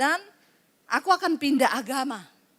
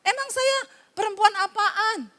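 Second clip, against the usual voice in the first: bingung dan tersinggung, tinggi dan keras; kaget bingung; 7 semitones higher than usual. Real, not acted. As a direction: protes heran sambil tertawa. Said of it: "saya juga bingung". (pendeta bingung menanggapi ibu di restoran)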